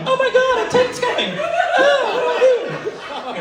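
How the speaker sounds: high squeaky panicked leprechaun voice. Retold or imitated. Boyfriend freaking out as a leprechaun, high panicked squeal. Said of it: "Just imagine a leprechaun going".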